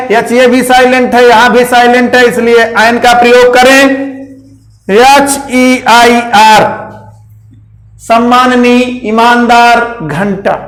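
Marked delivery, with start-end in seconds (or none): 4.9-6.6 एच ई आई आर spelled out slowly, letter by letter; slow spelling. Shown as a demonstration. Teacher slowly spelling heir for pupils, letter by letter. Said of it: "spelling heir slowly".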